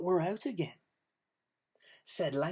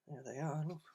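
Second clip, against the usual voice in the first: ordinary delivery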